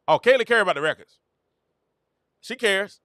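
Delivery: CARE pitched high and punched; emphatic insistence. Real, not acted. Fan rebutting that Caitlin doesn't care about records, insistent.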